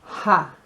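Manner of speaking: "ha" with the H sounded; wrong pronunciation. Shown as a demonstration. Teacher showing learners the mistake to avoid, "ha" with a sounded H. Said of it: "Don't be tempted to say".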